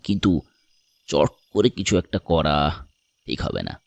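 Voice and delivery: deep, deliberate man's voice; pensive stranger. Acted. (নিচু গলায় ভেবে ভেবে বলা)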